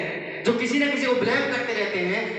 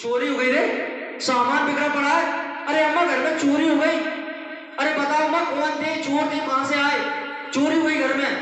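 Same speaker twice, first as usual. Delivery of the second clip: alarmed household; 6 semitones higher, 3 dB louder than usual. Acted. Family discovers a burglary.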